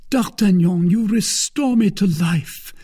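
joyful relieved cry; cried out. Acted. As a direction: burst out with relief. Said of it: "cried Aramis".

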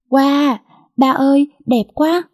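put-on high, happy child's cry; delighted exclamation. A narrator's reading. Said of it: "Nó kêu lên một cách sung sướng". (reo lên sung sướng)

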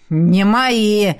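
terse, anger held back; barely held anger. Acted. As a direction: clamp down on the anger. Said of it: "едва сдерживаемая злость". (с едва сдерживаемой злостью)

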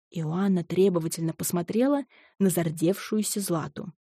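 ordinary delivery, nothing marked

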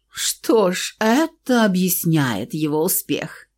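drawn-out, slow words; drawling. Acted. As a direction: stretch the words lazily. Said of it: "протянула Луиза".

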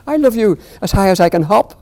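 high, loud bouncy cry; laughing little hare. Acted. Little hare bouncing up and down, high loud bouncy cry. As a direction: bounce it out delightedly. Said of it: "laughed Little Nut Brown Hare".

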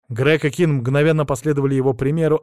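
ordinary delivery

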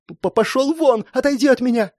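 high, stammering frightened woman; trembling voice. A narrator's reading. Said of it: "дрожащим голосом ответила женщина". (дрожащим женским голосом)

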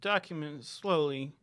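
high, odd put-on voice of a wounded photographer; whiny character voice. Wounded photographer complaining to interviewer, high whiny voice. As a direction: play the disappointed, sedated hipster.

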